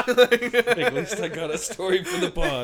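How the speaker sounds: high, loud words through laughter; laughing. Real, not acted. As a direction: laugh it out slowly. Laughing about the dog bite.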